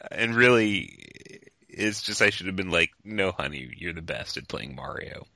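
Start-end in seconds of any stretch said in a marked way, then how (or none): 3.0-5.3 soft, low, gentle reassurance; gentle reassuring. Pretend. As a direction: soothe a little girl. Tender reassurance to daughter.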